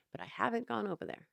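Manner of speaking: ordinary delivery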